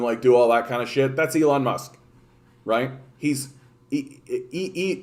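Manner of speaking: ordinary talk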